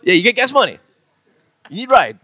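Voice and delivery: punchy mock Pidgin pickup questions; Pidgin uptalk. Shown as a demonstration. Co-host tops off pickup lines, loud Pidgin questioning. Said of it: "You're always talking up".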